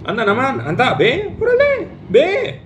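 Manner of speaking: mock-encouraging, pitch leaping up on órale; sarcastic God shooing him off; sarcastic permission. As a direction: say go while meaning don't. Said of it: "Dios le habló sarcásticamente".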